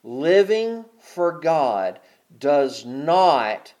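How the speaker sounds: drawn out, weighing each word; slow it right down so the church listens; slow deliberate emphasis. Acted. Preacher slowing down to make the congregation listen, slow and deliberate. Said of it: "y'all listen to me".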